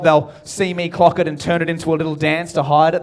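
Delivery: ordinary delivery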